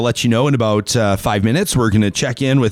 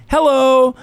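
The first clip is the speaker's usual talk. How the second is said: put-on high, louder child's hello; kid's louder hello; 4 dB louder than usual. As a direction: call out hello like a little boy, up high. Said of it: "or he'll say".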